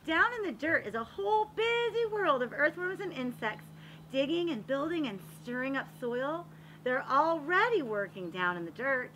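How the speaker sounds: lively, sing-song picture-book reading; reading to children. A narrator's reading. Reading a garden picture book to children, lively storytelling. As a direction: read it like story time for little kids.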